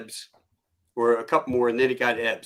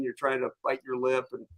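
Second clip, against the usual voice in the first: normal talking voice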